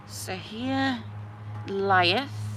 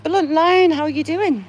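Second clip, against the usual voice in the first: cheery greeting; 8 semitones higher, 7 dB louder than usual. Pretend. Greeting a grave like a friend.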